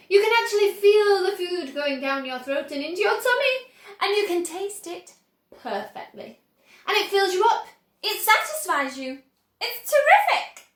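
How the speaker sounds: high, loud enthusing building to a peak; rapturous. A narrator's reading. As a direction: rave about it ecstatically. Wonka raving about the gum.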